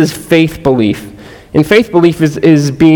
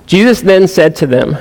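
usual speaking voice

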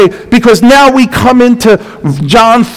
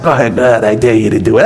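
mock dare; 11 semitones lower, 3 dB quieter, much faster than usual.

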